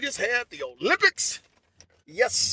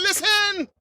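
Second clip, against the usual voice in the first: shouted listen; 6 semitones higher than usual. Retold or imitated. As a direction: call it out to calm them. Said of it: "I said".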